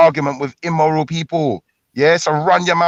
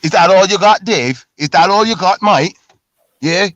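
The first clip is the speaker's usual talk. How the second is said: raised, higher-pitched goading challenge; loud taunt; 4 semitones higher, 4 dB louder than usual. Real, not acted. Arguer challenging Dave's weak insults, loud taunting. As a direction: throw it loudly at a rival, daring him.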